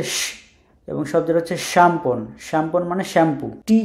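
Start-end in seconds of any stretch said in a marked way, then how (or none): 0.0-0.2 শ as a long vowelless sh; hushing sh. Shown as a demonstration. Teacher demonstrates Polish SZ, long hushing শ. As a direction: hold শ as a hiss of air. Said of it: "স্বর মতো উচ্চারণ করে".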